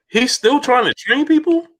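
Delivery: imitating his wife's disbelief; wife reacting to the cheating trainer, in a high shocked voice; mock incredulous wife.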